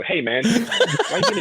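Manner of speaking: high, loud, laughing complaint; mock outrage. Guest pretending to call Steven about his question, mock outrage. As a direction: tell off a friend in playful indignation.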